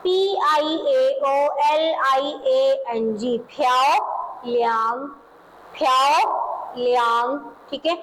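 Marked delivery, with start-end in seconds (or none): 0.0-3.4 letters spelled one by one, slow and even; spelling out slowly. Shown as a demonstration. Teacher slowly spells the word for students. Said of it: "उसके बाद next है आपके पास". 3.5-7.4 प्याओ लियांग drawn out slowly, प्याओ stressed with a rise; slow pronunciation model. Shown as a demonstration. Teacher models प्याओ लियांग slowly for students to copy. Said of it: "उसके बाद next है आपके पास".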